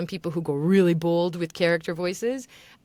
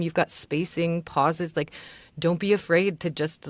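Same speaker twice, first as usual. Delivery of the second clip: ordinary delivery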